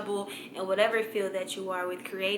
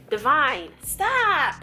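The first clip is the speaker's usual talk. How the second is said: squealed self-scold; high exclamation; 6 semitones higher, 5 dB louder, much slower than usual. Real, not acted. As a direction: cry out high and loud. Scolds herself by name.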